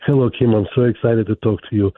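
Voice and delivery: normal talking voice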